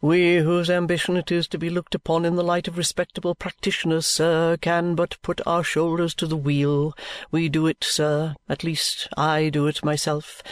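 low, measured, solemn lawyer voice; lawyer Vholes. A narrator's reading. Lawyer Vholes assures the guardian, low and solemn.

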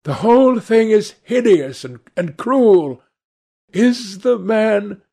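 high, loud, agitated protest; distraught. A narrator's reading. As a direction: protest in horror, then break off unfinished. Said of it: "He could not finish the sentence".

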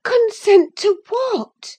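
high, slow anxious question; alarmed question. A narrator's reading. Catherine asking Linton, moved and alarmed. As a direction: ask gently but alarmed. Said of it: "moved and alarmed".

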